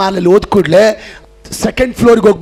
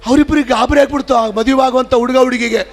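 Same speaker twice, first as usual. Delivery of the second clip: ordinary talk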